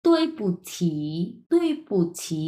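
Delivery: "তুই পু ছি" in three separated syllables; careful full-word model. Shown as a demonstration. Teacher puts the word together, syllable by syllable. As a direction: fall on "তুই", release "পু", then "ছি". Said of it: "ওই ছি হচ্ছে এটার অ্যাকচুয়াল উচ্চারণ".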